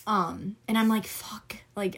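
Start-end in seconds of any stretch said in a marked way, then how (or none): 1.1-2.0 whispered curse. Retold or imitated. Inner thought, unvoiced.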